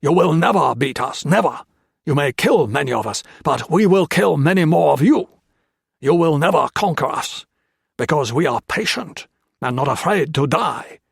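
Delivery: snarled threat. A narrator's reading. Japanese officer snarls threats at the prisoners, loud and hateful. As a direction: snarl it out with contempt. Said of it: "he snarled".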